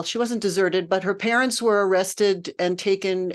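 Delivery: usual speaking voice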